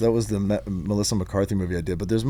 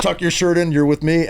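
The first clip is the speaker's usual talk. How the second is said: lightly voiced dad, a bit higher; dad's orders; 6 semitones higher, 4 dB louder than usual. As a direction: take charge like a dad out with his kid. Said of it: "He was like".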